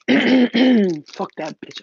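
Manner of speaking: loud, slow, stretched furious curse; angry overheard thought. Pretend. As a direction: spit it out like a husband after a fight. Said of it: "you start hearing".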